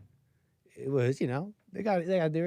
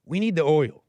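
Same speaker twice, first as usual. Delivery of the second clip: louder imitation of a grabby demand; mock blunt demand; 3 semitones higher, 7 dB louder than usual. Host mimics Americans wanting Latin America changed, mock demand. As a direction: put on a punchy, louder demanding voice.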